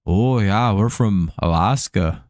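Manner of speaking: drawn-out, dopey mock-Alaskan accent. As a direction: play a slow-witted Alaskan. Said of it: "Could also be Alaskan".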